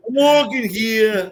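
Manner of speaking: high, slow "I'm walking here"; New York accent. Retold or imitated. Friend redoes his New York line, high and slow. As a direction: squeak it like a soprano reject. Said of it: "like a soprano reject".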